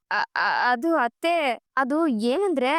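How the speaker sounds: slow, halting start as if caught out; nervous stammer. Acted. Daughter-in-law makes excuses to mother-in-law, hesitant stammer. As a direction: stall with stuttered false starts.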